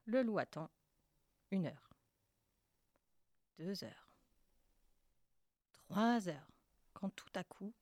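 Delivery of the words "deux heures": ordinary delivery, nothing marked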